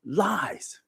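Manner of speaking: mock whispered scoff; muttered whisper. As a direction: breathe the word under your breath, scornful. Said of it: "I was like".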